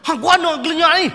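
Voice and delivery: loud, high startled shout; mock yell. Teacher shouting at a startled girl, loud mock yell. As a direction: snap at the girl. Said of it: "Dia kata".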